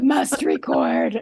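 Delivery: slow, strained mock croak; imitating lost voice. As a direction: drag it out like a voiceless zombie. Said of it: "I lost my voice".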